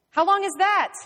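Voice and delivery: loud, high shouted question; exasperated demand. Acted. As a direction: press hard for an answer. Inspector presses for an answer.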